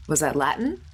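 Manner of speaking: ordinary talk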